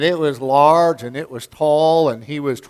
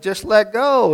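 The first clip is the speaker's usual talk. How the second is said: a hint of a higher heavenly voice; 7 semitones higher than usual. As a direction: call it down gently from above. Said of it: "And the voice said".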